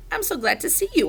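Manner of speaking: high little hamster voice; squeaked. A narrator's reading. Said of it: "I squeaked".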